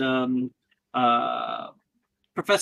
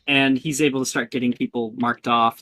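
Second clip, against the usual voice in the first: ordinary talk, nothing marked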